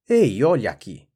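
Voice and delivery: chamado alto e ríspido; voz rude. A narrator's reading. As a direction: grite rudemente, como quem repreende. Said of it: "respondeu uma voz rude". (brado rude e forte)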